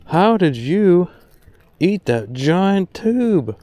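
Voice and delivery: slow, high, drawn-out incredulous question; playful disbelief. Real, not acted. Angler asking a tiny fish about the giant tube, incredulous. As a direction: stretch each word, pitched high in amazement.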